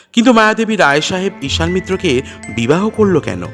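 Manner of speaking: usual speaking voice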